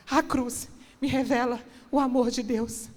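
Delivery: ordinary delivery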